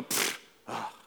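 breathy dismissive pfft and ugh; mock scoff. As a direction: scoff like someone rolling their eyes. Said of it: "they kinda roll their eyes and go".

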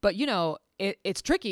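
usual speaking voice

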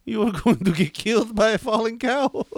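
a scolding mom warning her son; mock mother voice.

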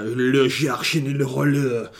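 slow mock French that comes out like mock Japanese; botched French. Naming a favourite film, a botched mock French accent. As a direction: drawl the title in a bad fake foreign accent. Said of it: "I don't know why I did Japanese".

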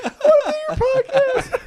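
squealing high through laughter, begging to be on the show; hammed-up pleading, breaking into laughs; laughing mock plea. Friend retelling how he begged to get on the podcast, laughing mock plea.

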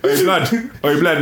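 high, hammed-up slang voice, breaking into a laugh; mock street slang greeting. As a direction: tease like a street kid greeting his mate. Joking banter about Thanos.

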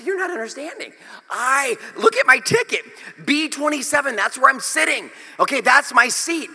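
high, pleading protest; exasperated insistence. Retold or imitated. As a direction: argue your case with rising frustration. Acted argument with gate agent.

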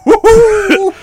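high loud whoop; excited cheer. Real, not acted. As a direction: whoop like a fan. Cheering for the actor.

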